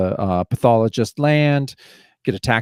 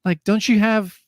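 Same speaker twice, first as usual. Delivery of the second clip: high, mock-indignant outburst; imitating mad cops; 10 semitones higher than usual. Cops scold the pathologist, mock angry high rant. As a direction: play the fed-up cop, hammed-up. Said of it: "'cause I would be mad too".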